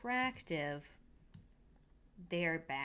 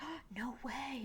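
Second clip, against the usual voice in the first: whisper; 7 semitones higher, 5 dB quieter than usual. Retold or imitated. Mean girls react to Colin.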